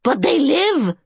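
put-on high, pleading cry of the old keeper; desperate. A narrator's reading. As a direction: beg him to see the danger. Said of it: "the man said desperately".

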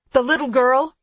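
sharp, loud question; snapped. A narrator's reading. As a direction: demand it abruptly. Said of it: "I snap".

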